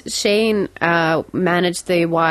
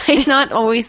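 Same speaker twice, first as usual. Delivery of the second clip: usual speaking voice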